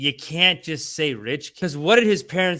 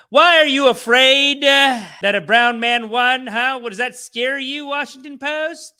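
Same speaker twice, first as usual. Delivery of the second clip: high, loud, slow mocking rant; loud taunting challenge; 6 semitones higher, 8 dB louder than usual. Real, not acted. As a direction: call out the paper, daring it. Berating the newspaper.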